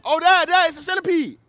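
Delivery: put-on very high, loud kid squeal; imitated excited child. Son showing dad a centipede, high loud mock excitement. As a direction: shout out like a thrilled little boy.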